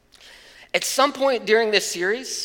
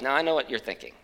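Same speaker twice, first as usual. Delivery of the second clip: normal talking voice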